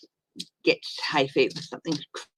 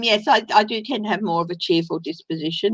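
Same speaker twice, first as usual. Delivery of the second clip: ordinary delivery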